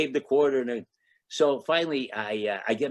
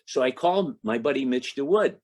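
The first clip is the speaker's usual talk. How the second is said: ordinary delivery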